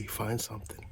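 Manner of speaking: ordinary talk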